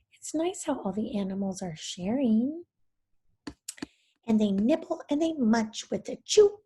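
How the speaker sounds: ordinary delivery, nothing marked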